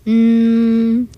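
mosquito hum. A narrator's reading. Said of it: "tiny mosquitoes".

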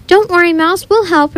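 high, calling after someone. A narrator's reading. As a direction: call out reassuringly. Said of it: "Rebecca called after him".

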